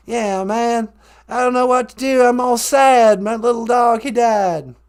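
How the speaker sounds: hammed-up sad folksy Texan; mock-sad George W. Bush. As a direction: mourn the pup in the Bush voice. Held Bush impression, sad.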